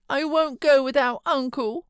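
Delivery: put-on a fretful child pleading to stay; sickly child. A narrator's reading. Boy refusing to leave his uncle, high fretful whine. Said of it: "he cried at length".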